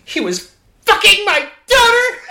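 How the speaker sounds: high, laughing mock outrage; mock outraged yell. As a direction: belt it out like a soap opera reveal. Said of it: "Should I say it".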